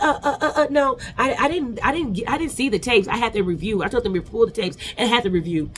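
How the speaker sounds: high, halting stammer imitating Phaedra; mock stuttering. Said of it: "stuttering shit".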